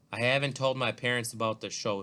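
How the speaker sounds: usual speaking voice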